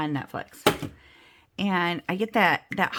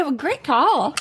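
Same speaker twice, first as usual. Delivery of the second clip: higher, louder, upbeat; sing-song praise on the phone; customer service voice; 8 semitones higher, 7 dB louder, slower than usual. Retold or imitated. Her own phone voice.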